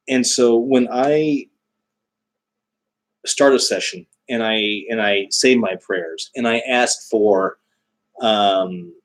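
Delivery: normal talking voice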